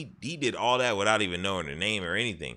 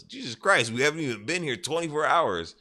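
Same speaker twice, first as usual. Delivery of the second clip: exasperated disbelief; 8 semitones higher than usual. Retold or imitated. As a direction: throw your hands up, much higher voice. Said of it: "He goes".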